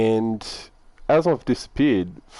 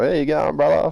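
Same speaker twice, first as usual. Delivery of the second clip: hearty greeting; 7 semitones higher than usual. Retold or imitated. Greeting the trucker enthusiastically.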